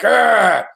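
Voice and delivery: loud snarling growl. Retold or imitated. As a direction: snarl like a big fighter. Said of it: "he's like".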